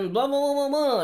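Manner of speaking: high mock announcer blah blah; radio announcer voice. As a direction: keep the nasal radio-host voice going. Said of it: "weird nasally sound that they had".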